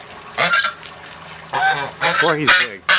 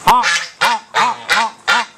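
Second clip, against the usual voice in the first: imitating goose honks. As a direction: honk like the geese, sharp and quick. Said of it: "All they do is honk".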